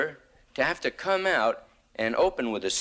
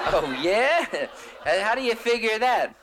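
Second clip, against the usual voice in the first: mock incredulous retort; 10 semitones higher than usual. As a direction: throw it back at the censor in mock disbelief. Mock indignant comeback.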